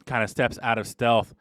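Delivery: ordinary delivery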